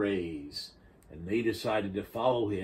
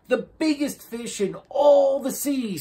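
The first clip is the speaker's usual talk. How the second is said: excited Turtle; 9 dB louder than usual. A narrator's reading. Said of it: "said Turtle".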